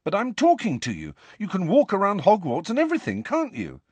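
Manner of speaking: high, insistent argument; urging. A narrator's reading. Harry urges Nick to admit ghosts live on, insistent. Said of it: "Harry urged him".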